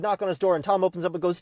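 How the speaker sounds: ordinary talk, nothing marked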